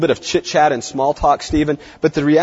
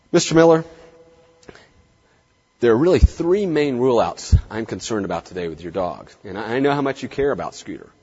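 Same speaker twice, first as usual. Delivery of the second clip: serious vet breaking hard news, with long pauses; grave, lowered tone; 4 semitones lower than usual. Shown as a demonstration. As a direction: lower the tone and let each phrase fall. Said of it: "lowering the tone, downward inflection, slowing the rate, using silence, using pauses".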